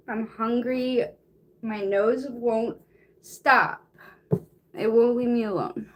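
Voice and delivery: plain reading voice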